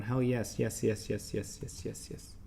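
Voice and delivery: mock hype dying away to a whisper; fading mock cheer.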